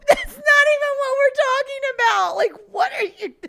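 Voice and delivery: laugh-crying. Real, not acted. Teacher losing it at the nephew, laugh-crying. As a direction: lose it laughing.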